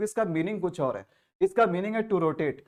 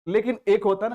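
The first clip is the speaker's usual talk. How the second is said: normal talking voice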